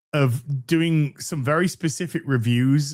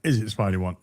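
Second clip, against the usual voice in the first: normal talking voice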